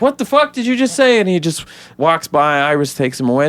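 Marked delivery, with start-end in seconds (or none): 0.0-1.2 high, mock baffled outburst; baffled reporters. Reporters reacting to Barry's nonsense, high mock disbelief. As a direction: act the stunned press, pitched up. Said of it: "And they're like".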